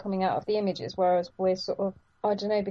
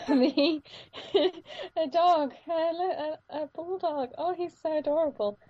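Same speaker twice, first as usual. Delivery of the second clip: giggly, high-pitched adoration; gushing; 9 semitones higher than usual. Real, not acted. Podcaster melting over a bulldog, gushing. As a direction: coo over an adorable dog.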